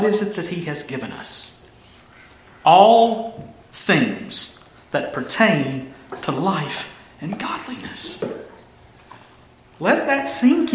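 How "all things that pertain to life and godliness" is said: slow, soft and low, spaced out; hushed slow reveal. Real, not acted. Preacher answering what God has given us, hushed and slow. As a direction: let each phrase sink in quietly.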